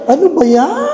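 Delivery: ordinary talk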